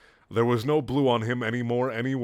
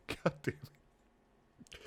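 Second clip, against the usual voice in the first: laughing; 14 dB quieter, much slower than usual. Real, not acted. Breaking up laughing at text.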